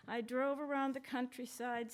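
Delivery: usual speaking voice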